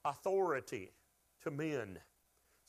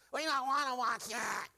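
whiny flesh voice; 8 semitones higher than usual. Retold or imitated. Preacher voicing his own flesh, whiny higher voice. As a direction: pout it out like a craving appetite. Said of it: "my old flesh goes".

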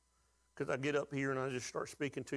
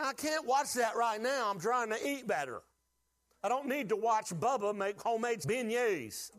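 lightly higher, exasperated protest; mock self-talk; 6 semitones higher than usual. Pastor quoting himself refusing food shows, lightly exasperated. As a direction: talk yourself out of temptation. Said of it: "I'm like".

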